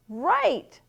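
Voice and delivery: loud, high "Right" falling decisively; confident confirming. Acted. Bunny confirms to his parents as he leaves, confident. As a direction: punch it out brightly, certain.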